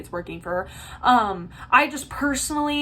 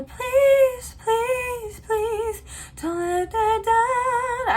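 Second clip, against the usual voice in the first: high, airy, slow breathy singing; 12 semitones higher, 4 dB louder, much slower than usual. Retold or imitated. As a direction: sing it mostly air, high up. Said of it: "breathy tone that she does".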